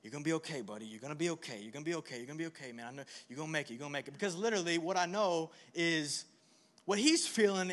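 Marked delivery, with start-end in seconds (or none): 0.0-4.1 put-on soothing baby. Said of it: "just going".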